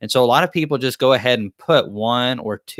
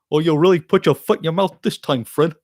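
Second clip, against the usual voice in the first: higher-pitched cartoon sidekick voice; Barney Rubble; 6 semitones higher than usual. Teasing Fred about putting his foot in his mouth, amateurish Barney Rubble impression. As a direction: do an amateurish, hammed-up cartoon pal. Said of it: "a terrible impression of Barney".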